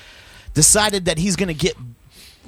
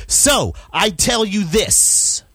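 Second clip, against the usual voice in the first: angry; 6 semitones higher, 3 dB louder, slower than usual. Real, not acted. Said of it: "This is angry".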